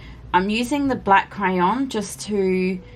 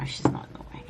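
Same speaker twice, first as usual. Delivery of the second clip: whisper; 7 dB quieter, much faster than usual. Real, not acted. Answering softly while cat nearby.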